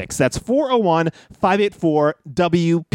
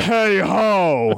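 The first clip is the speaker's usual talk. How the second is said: drawn-out catchphrase impression; The Godfather. Host in speed round of impressions, drawn-out mock voice. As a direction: stretch both words in a silly voice. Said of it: "The Godfather".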